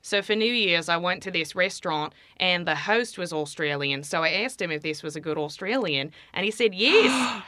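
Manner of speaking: demonstrating an Australian accent. Shown as a demonstration. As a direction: tell it in Australian, tongue back in the throat. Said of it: "I guess I'll do it in Australian".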